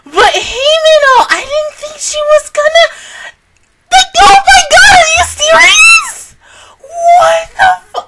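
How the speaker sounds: high, loud, half-crying wail. Real, not acted. Mourning Himeno's death, wailing high and loud. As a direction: wail in real disbelief over the loss.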